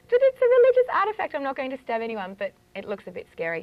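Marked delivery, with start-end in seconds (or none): 0.1-2.3 loud, high-pitched hammed-up protest; mock protest. Witch retelling being caught with the athame, mock-indignant protest. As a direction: plead innocence in a much louder, higher mock panic. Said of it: "And I'm going like".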